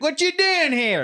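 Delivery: high, slow threatening drawl; menacing southern guy. Retold or imitated. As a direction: challenge an outsider, slowly. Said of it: "For me, it's like".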